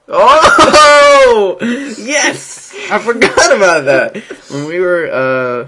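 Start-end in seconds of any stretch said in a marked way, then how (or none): 0.1-4.2 loud laughing realization; excited. Real, not acted. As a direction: burst out laughing as it comes back. Sudden memory of the funny commercial.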